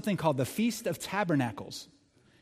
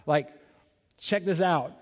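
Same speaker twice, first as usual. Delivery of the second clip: usual speaking voice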